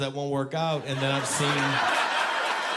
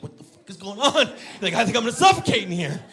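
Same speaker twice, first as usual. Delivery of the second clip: rising hammed-up panic to a high shout; mock panic building; 9 semitones higher, 6 dB louder than usual. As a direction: panic louder and higher to the end. Suffocating in duvet.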